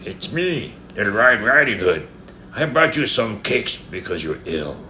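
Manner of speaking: high, put-on girl voice from a wolf; wolf disguising gruffness. A narrator's reading. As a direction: pose as the child, hiding your gruffness. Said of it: "trying hard to hide his gruff voice".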